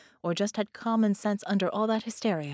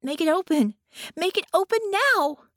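put-on loud, high frantic child; a child desperate for the door; rising louder and higher in panic; frantic demand; 10 semitones higher, 7 dB louder than usual. A narrator's reading. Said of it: "she demanded, more frantic than imperious".

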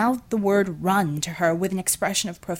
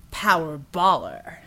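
Brad's slow voice; 3 semitones lower, slower than usual. A narrator's reading. Brad proposes a name, slow.

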